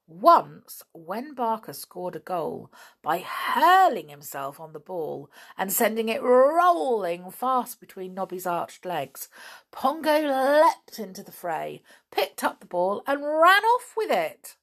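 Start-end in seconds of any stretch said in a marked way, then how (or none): none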